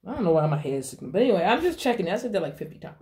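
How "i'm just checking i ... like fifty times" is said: ordinary talk, nothing marked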